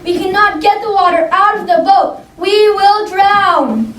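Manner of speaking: high, panicked cry; frightened cry. A narrator's reading. Peter crying out to the others in the storm, high and panicked. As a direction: cry out as the boat fills. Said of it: "cried Peter".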